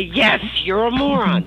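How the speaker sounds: high, loud berating cry; mother scolding. Retold or imitated. Mother insulting Ann in the car, loud scolding. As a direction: snap at her daughter from the passenger seat.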